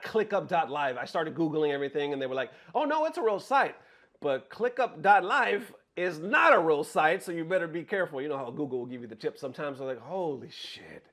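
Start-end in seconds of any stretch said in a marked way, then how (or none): 2.7-3.7 high-pitched reassuring quote; voicing search results. Search results vouching for the site, high put-on voice. 10.0-11.1 slow, low, hushed disbelief; stunned realization. Retold or imitated. Victim discovering the site is fake, quiet stunned realization. Retold realization he was fooled.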